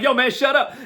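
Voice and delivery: high, blurted at full voice; said out loud. Shown as a demonstration. Wrong take, telling himself to shut up out loud. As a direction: snap it out loud like talking to someone. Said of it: "No, that's not what it is".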